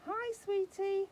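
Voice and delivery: high-pitched, drawn-out sing-song; sing-song call. Shown as a demonstration. Owner triggering the talking baby doll, high sing-song call. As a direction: coo it high and slow to a baby.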